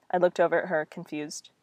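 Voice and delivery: ordinary delivery, nothing marked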